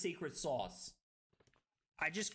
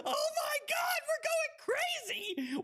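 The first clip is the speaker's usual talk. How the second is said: excited shout; faster than usual. Real, not acted. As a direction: yell it out in triumph. Big play win on stream.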